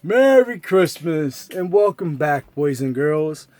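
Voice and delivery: put-on slow, warm welcome to children; storyteller greeting. Storyteller greeting the boys and girls. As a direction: greet the kids like a storybook host.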